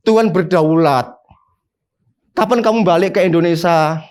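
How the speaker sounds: ordinary delivery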